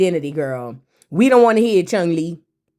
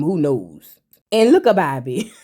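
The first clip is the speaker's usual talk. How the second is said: normal talking voice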